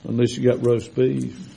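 usual speaking voice

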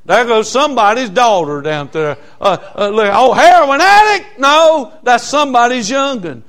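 high, loud, pleading call; impassioned preaching. Real, not acted. Preacher urging the church to see addicts as somebody's children, impassioned. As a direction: plead for them to see people differently.

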